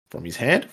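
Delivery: sharp questioning rise on "hand"; puzzled question. Real, not acted. Reader doubting his own written fluff, puzzled question. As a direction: query "hand" as if you misread it, pitch climbing at the end. Oh, yeah, that's right.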